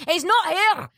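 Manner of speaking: high-pitched, ranting Scottish shop worker; Scottish accent. Retold or imitated. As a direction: imitate an exasperated Scottish local. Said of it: "They just speak fucked up English".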